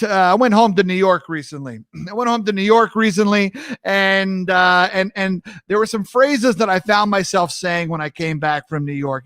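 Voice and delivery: normal talking voice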